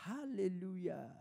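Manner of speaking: slow soft parrot echo. Retold or imitated. Parrot copying its owner's hallelujah, slow and soft.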